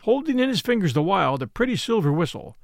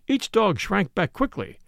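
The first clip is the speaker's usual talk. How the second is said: ordinary delivery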